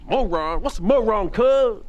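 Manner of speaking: high, loud confused tough guy; a street tough baffled by the word; puzzled gangbanger. Retold or imitated. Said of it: "He said".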